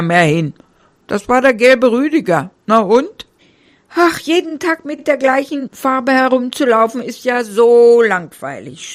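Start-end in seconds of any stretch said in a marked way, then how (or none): 3.9-8.9 higher-pitched moan of boredom; bored groan. A narrator's reading. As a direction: moan it, stretching the complaint. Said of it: "stöhnte Rüdiger". (gelangweiltes Stöhnen)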